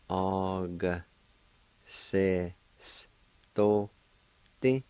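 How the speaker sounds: Og, se, sto, Ti each said apart with pauses; syllable by syllable. Shown as a demonstration. Teacher sounding out Og se sto Ti for beginners, syllable by syllable. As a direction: break Og se sto Ti into separate syllables. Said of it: "you can pronounce the G sound with the A like this".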